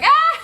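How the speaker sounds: high, loud mock scream into laughter; retold joyful scream. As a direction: scream with joy, then laugh it out. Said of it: "outside screaming ... laughing though".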